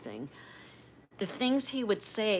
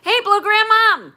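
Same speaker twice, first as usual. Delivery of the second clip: loud, high, excited hello; calling out; 13 semitones higher, 15 dB louder than usual. Real, not acted. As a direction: call out happily across the room to a viewer. Greeting a viewer by name.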